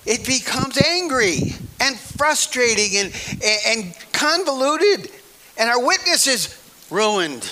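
loud, high, slow preaching climax; preaching at full force. Real, not acted. Preacher warning the congregation, rising to a loud cry. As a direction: drive it home from the pulpit, slowing.

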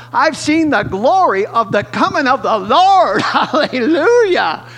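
preaching at full force, voice climbing into hallelujah; ecstatic climax. Real, not acted. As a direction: lift it up in rising praise.

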